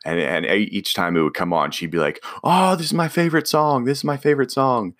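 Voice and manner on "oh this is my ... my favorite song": high-pitched, gushing imitation of an excited fan; put-on squeal of delight; mock excitement. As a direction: gush in a much higher voice, imitating her. Imitates sister thrilled by song.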